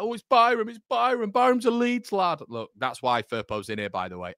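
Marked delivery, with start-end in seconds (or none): 0.0-2.4 high, loud mock pleading; mocking fans' whine. Imitating fans who wanted Byram picked, mocking high whine. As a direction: parody the doubters in a raised voice. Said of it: "For all you lot who are like".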